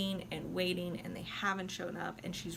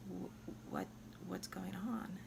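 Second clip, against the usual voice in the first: whisper; 10 dB quieter, slower than usual. Retold or imitated. Alfredo seeing Violetta collapse.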